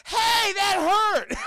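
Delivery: very high, loud, drawn-out whiny shout; mock dubbed yell. Dubbed line as kid gets kicked, mock pained yell. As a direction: squawk it like a badly dubbed kid. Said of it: "this A D R that comes in".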